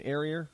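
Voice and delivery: "area" with an R added on the end; intrusive R. Shown as a demonstration. Host showing misplaced Boston R's, "area" ending in an R. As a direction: say "area" as "arear", Boston style. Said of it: "You put your R's where they don't belong".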